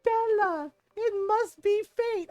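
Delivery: loud, high mock pickup line; hammed-up flirty stranger calling her name; loud, high imitation of a creepy man; creepy guy calling out.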